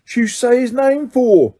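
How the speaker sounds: high-pitched frightened cockney protest; rattled and scolding; alarmed. A narrator's reading. Stan still alarmed.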